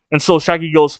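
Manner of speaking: ordinary talk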